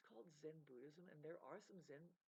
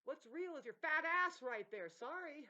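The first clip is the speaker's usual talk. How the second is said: loud insult; 9 semitones higher, 14 dB louder, slower than usual. Real, not acted. Insulting outburst.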